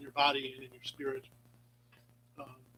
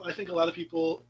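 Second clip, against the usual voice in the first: ordinary talk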